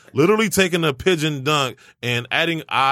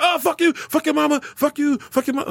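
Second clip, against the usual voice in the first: very high-pitched mock tirade; going off. Telemarketer going off on him and his mama, high mock rant. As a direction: imitate a caller ranting, voice pitched way up. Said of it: "started going off on me and my mama".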